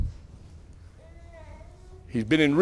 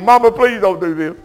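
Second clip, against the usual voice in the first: imitating a pleading child, louder; raised, pleading kid's voice; begging child; 4 semitones higher, 7 dB louder than usual. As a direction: beg mama like a scared boy. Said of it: "me begging".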